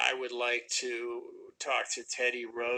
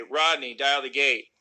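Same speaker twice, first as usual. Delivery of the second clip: louder, snapping the order at Rodney; impatient bark; 2 semitones higher, 6 dB louder, slower than usual. Shown as a demonstration. Actor showing how he snapped the line at Rodney. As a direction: bark it at him like he's slow. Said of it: "I would say".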